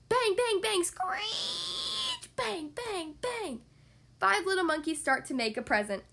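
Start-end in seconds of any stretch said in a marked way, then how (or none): 0.1-3.6 sound effects. Acted. Sound effects of making present.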